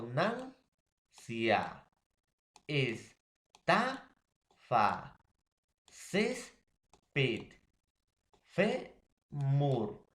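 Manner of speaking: nancia, estafa, césped, femur broken into long syllables, NAN, TA, CÉS, FE stressed; stress on penultimate syllable. Shown as a demonstration. Teacher explaining graves better to students, syllables split and stressed. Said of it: "acá explicamos mejor".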